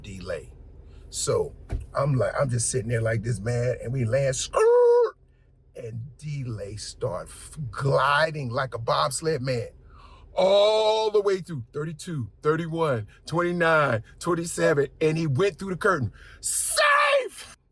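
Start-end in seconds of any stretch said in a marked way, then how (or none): 4.3-5.2 high, loud screech; mock screech of tires. Landing sound effect. 16.4-17.6 mock baseball umpire shout; umpire call. Umpire call as D-Lay slides.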